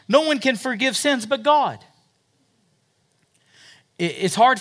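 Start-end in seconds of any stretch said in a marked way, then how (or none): 0.1-1.8 höher und lauter, empört: Why, this man says he can forgive sins; indignant scribes. Acted. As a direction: als entrüsteter Schriftgelehrter, höher und lauter. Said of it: "They say".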